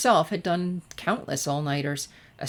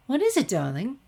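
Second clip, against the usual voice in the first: Rarity; 7 semitones higher than usual. A narrator's reading. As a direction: sing out darling like a fine lady. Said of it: "asked Rarity".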